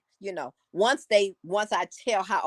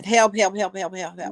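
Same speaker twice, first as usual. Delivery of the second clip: mild mock holler; 2 semitones higher than usual. A narrator's reading. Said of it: "The man still was hollering".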